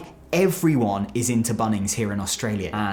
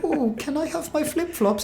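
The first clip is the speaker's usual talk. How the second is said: mocking roast. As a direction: tease them in an exaggerated high put-on voice. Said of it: "They absolutely roast me".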